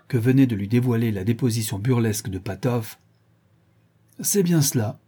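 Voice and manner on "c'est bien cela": higher-pitched, mildly strident confirmation. A narrator's reading. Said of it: "dit-il d'une voix stridente".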